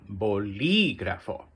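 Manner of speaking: "bolígrafo" slow, with the í stretched out; exaggerated stress. Shown as a demonstration. Teacher repeats the word for students, exaggerated stress on the "lí" of "bolígrafo". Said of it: "just put extra stress".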